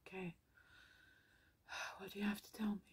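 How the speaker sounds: fast breathy whisper. Real, not acted. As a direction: whisper the question to the spirits. Asks unseen beings for message.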